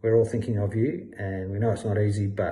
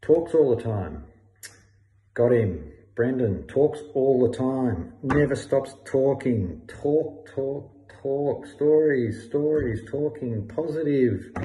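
higher, slow, drawn-out words; emphatic recognition; 3 semitones higher, 3 dB louder, slower than usual. Acted. Finally placing Brendan who never stops talking, emphatic recognition. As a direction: hammer each word slowly as it comes back to you.

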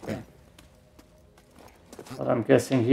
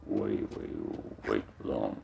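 deep voice; 16 dB quieter than usual. Pretend. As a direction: drop the voice way down low. Repeats phrase very low.

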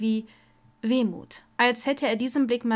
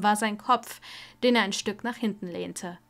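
ordinary delivery

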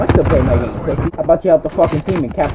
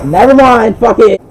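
frustrated outburst; 6 semitones higher, 8 dB louder than usual. Real, not acted. Giving up mid-fight.

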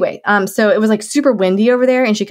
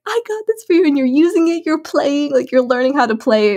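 put-on high-pitched proud gush to a baby; retold delight, pitched way up, easing down at the end; 6 semitones higher than usual. Mother watching her baby on the play mat, delighted high gushing.

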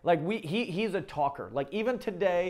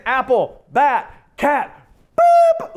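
climbing higher and louder to a squeaky boop; sing-song build; 6 semitones higher, 11 dB louder, much slower than usual. Retold or imitated. Parent running the ABC list, ending in a high, loud squeak. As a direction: bounce through the list, pop the last word. Parent expecting dog, cut off.

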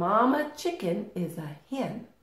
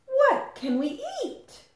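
very high tiny chick voice; little chick. Acted. First little chick asking Mother Hen for food, tiny high voice. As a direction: squeak it like a baby chick. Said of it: "Said the fourth little chick".

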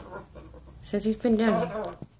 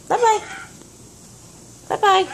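pitched-up sing-song goodbye; high bye-bye to bird; 13 semitones higher than usual. Real, not acted. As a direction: coo goodbye.

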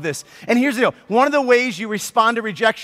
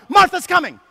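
higher, louder call of Martha's coming; shouted warning; 8 semitones higher, 4 dB louder than usual. Pretend. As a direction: yell it like running past. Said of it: "he yells it out".

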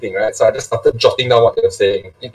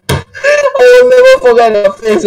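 laughing; 3 dB louder, slower than usual. Real, not acted. Laughing while recalling stunned classmates.

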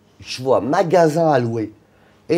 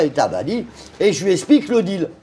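ordinary delivery

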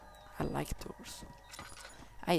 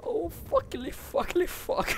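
flustered fright; 13 semitones higher, 6 dB louder than usual. Real, not acted. Flustered after a scare.

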